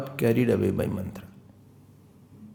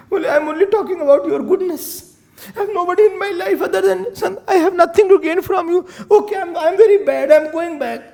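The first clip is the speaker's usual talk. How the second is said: high, loud whining self-pity; fake weeping servant; 12 semitones higher, 4 dB louder than usual. Manthara fakes wounded tears to Kekai, high whine. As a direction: whine wounded, staging hurt.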